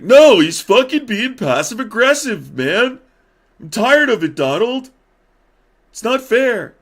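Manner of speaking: put-on high, loud childish protest; J D Vance. Vice president protesting to president, mock whiny shouting. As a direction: yell it like a wronged kid. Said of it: "And he's like".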